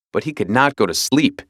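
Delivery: ordinary delivery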